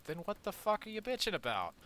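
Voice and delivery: incredulous, loud snap at a whiner; exasperated outburst. Real, not acted. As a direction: throw the question back in disbelief. Exasperated retort to complainer.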